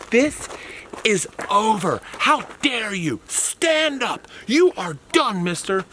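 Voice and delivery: mock-outraged teacher voice; scolding chaperone.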